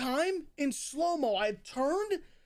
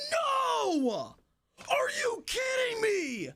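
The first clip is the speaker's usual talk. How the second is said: retold angry shout; 9 semitones higher, much slower than usual. Gambler at the blackjack table shouting in disbelief. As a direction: yell it like you just lost big. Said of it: "All I hear is".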